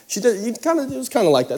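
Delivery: ordinary talk, nothing marked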